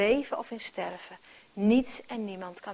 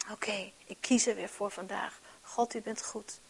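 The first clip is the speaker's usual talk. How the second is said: soft, hushed personal resolve; a believer whispering thanks to God; whispered prayer; 6 dB quieter than usual. Pretend. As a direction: murmur it to yourself in quiet trust. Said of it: "tegen jezelf mag zeggen".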